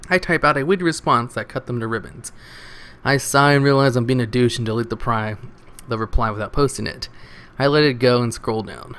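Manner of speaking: ordinary talk, nothing marked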